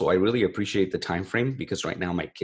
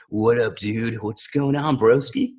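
lightly voiced mock surfer dude, higher and a bit louder by the end; a hint of a laid-back California surfer greeting a buddy; playful surfer-bro impression, only lightly put on; 4 semitones higher, 3 dB louder, slower than usual. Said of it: "it's just that kind of tone".

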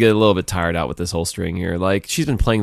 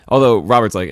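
normal talking voice

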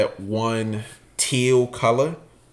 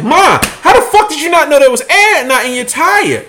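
high, loud exasperated scold; scolding mother; 13 semitones higher, 6 dB louder than usual. Retold or imitated. Davon scolding his mother about the tire, loud and high. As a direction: yell at your mom in disbelief. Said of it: "I'm like".

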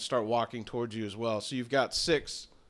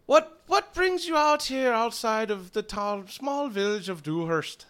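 higher-pitched Irish villager; Irish accent; 7 semitones higher than usual. Acted. Druid greeting travellers outside the village, Irish accent. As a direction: lilt it like an Irishman. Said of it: "now you sound like an Irishman".

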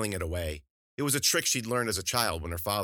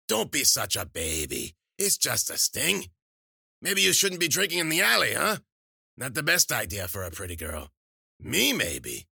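put-on scolding old crone; 5 semitones higher, 5 dB louder than usual. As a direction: chide the patient like a crabby crone. Old crone scolds her patient.

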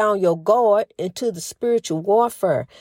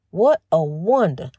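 "What a wonder" said slower and lower, savoring it; slow musing; 6 semitones lower than usual. Real, not acted. To her listeners, repeating the phrase slowly and low in awe. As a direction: let it sink in, drawn out and low.

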